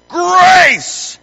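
high, loud bark of a name; sudden scolding holler; shouted name. Retold or imitated. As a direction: bellow it like a dad catching his kid. Acts out yelling at his daughter.

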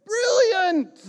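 high, loud, jubilant British cry; very high, delighted exclamation in British accent; enthusiastic British diver. Retold or imitated. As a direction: imitate an elated British rescuer calling out. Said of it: "with his enthusiasm".

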